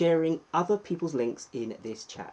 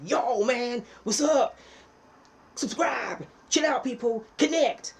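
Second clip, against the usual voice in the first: much higher, louder mock American hype voice; American YouTuber; 10 semitones higher, 5 dB louder than usual. British streamer mocking American YouTubers, high mock American hype. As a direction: parody a hyped-up American YouTuber. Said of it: "especially the Americans, where they're like".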